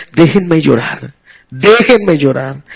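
slow, low and soft, drawn out in grief. Retold or imitated. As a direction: plead to be left alone to mourn, lingering. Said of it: "No, es".

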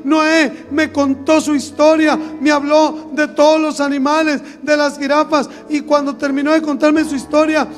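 normal talking voice

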